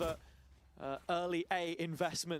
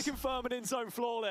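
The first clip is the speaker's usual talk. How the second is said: big hype call; 5 semitones higher than usual. Real, not acted. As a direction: belt out the round win. Caster calling round-winning flawless.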